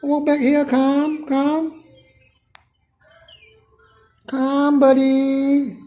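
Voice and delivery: lifted, carrying voice for a bird on the sill; coaxing a bird. Real, not acted. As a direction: call sweetly to a bird to lure it back.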